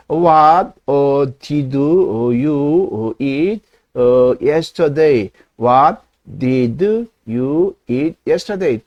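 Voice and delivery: halting word by word, with "어" fillers; halting English. Shown as a demonstration. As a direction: stumble through "What did you eat yesterday?" one word at a time. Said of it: "떠듬떠듬". (더듬더듬 끊어 말하기)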